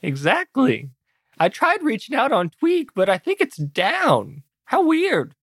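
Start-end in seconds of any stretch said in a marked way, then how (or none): 1.4-5.3 high, lilting feigned puzzlement; mock innocent. Attacker feigns surprise to Carl about the outage, mock-innocent. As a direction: play dumb sweetly, stretching the middle phrase.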